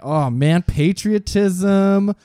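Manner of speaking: high, drawn-out patriotic gushing; hammed-up national pride, climbing in pitch; mock patriotism. Celebrating the fourth of July, mock patriotic fervour.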